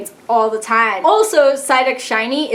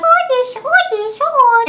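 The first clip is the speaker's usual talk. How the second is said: much higher squeaky creature call, falling each time; Oddish cry; 12 semitones higher than usual. Retold or imitated. Imitating the Oddish cry for viewers, high and squeaky. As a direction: chirp the name like a tiny plant creature. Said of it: "its cry is ridiculously adorable".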